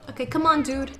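normal talking voice

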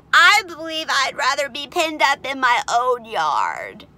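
high, loud, slow piglet lament; drawn-out upset complaint; distressed young pig. A narrator's reading. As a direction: wail it like an overwhelmed little pig. Overwhelmed pig wishes himself home.

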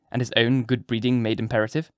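plain reading voice

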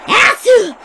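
sudden loud sneeze; sneezing. Real, not acted. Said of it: "I just sneezed".

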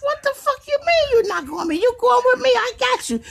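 begging the toy to come along, high and urgent; high exasperated pleading. Retold or imitated. Arguing with the toy.

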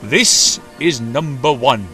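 slow, loud proud introduction; big deliberate presentation. A narrator's reading. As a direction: announce it proudly, taking your time. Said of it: "the Thin Controller said proudly".

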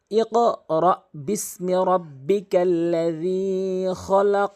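slow, loud Quranic recitation; careful recitation. Shown as a demonstration. Teacher demonstrating the verse to students, slow and loud. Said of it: "দুইটি নিয়ম খেয়াল করুন".

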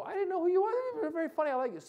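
high-pitched impression of a surprised new fan; pleased audience member. Audience member to comedian after the show, pleasantly surprised. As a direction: lift it way up, imitating an admirer. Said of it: "and after the show".

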